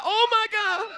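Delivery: high, loud, amazed laugh; laughing amazement. Retold or imitated. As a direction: burst out in delighted shock. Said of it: "I was like".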